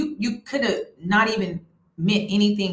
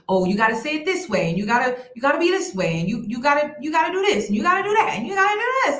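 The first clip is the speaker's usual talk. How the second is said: rising higher with each demand; mocking nagging voice; 6 semitones higher, faster than usual. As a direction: mimic the endless rules, climbing in pitch.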